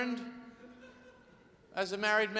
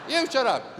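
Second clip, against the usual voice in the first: loud, higher-pitched retort; angry husband; 4 semitones higher, 5 dB louder than usual. Acted. Husband snapping at his mimicking wife, loud and angry. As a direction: fire it back loud and high. Husband snaps back in acted row.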